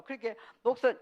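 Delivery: usual speaking voice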